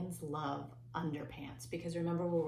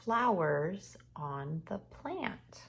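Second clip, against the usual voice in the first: slow dictation; 4 dB louder, much slower than usual. Shown as a demonstration. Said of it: "The next thing says".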